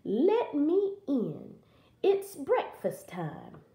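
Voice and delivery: wolf imitating a little girl; faked girl's voice. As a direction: wheedle slowly as a wolf posing as a girl. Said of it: "tried to have a girl's voice".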